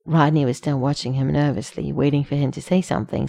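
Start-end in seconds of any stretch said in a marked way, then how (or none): none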